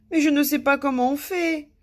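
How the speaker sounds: high, loud helpless whine; tearful sniffling. A narrator's reading. King cannot manage to be happy, tearful. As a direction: whimper helplessly. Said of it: "répondit le roi en reniflant".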